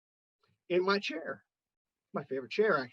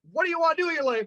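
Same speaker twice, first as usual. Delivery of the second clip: dad; 9 semitones higher, much faster than usual. Retold or imitated. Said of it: "the dad says".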